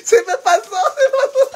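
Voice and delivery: high, loud words dissolving into laughter; helpless laughter. Real, not acted. Mocking the excuse to viewers, helpless laughter. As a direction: squeal it out while cracking up.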